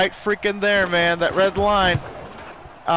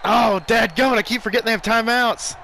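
frustrated; 5 semitones higher, 4 dB louder than usual. Real, not acted. Frustrated at opponent timeout.

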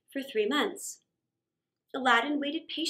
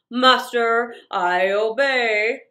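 much louder, slow, lower genie voice; booming genie; 4 semitones lower, 9 dB louder, much slower than usual. Acted. The genie answers Aladdin's rubbing, booming and slow. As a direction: boom from inside the lamp like a spirit.

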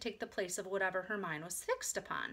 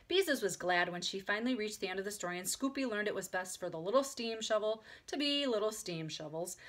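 ordinary delivery, nothing marked

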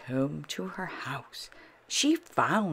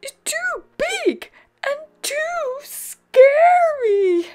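very high, loud wail; tearful protest; 14 dB louder than usual. A narrator's reading. Posy finds Pip's dinosaur scary, high tearful protest. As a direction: whine it like an upset little one.

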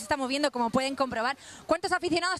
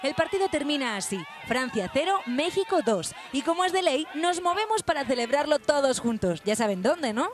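news voiceover; 5 semitones lower than usual. A narrator's reading. Reporter narrating the match for viewers, news read.

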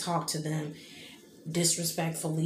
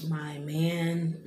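normal talking voice

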